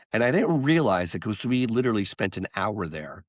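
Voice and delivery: ordinary delivery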